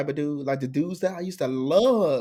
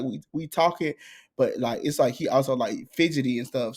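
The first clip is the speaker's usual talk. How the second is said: ordinary talk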